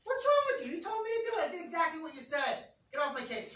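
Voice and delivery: high, loud exasperated yell; husband shouting back at wife who is mad; defensive outburst. Retold or imitated. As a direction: snap back in self-defence. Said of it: "what should his response logically have been".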